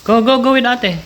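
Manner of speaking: very high, loud cheering; urging on. Real, not acted. Parent urging child to go.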